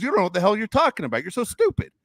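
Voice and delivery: high, fast, dismissive comeback; irate retort. Retold or imitated. Dad insulting his son mid-argument, irate retort. Dad's retort in acted quarrel.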